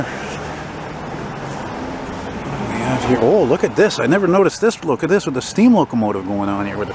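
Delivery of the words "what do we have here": hushed, drawn-out musing; slow whispered repeat. Real, not acted. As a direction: whisper it slowly to himself. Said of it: "hey, what do we have here?".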